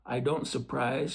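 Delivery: ordinary delivery, nothing marked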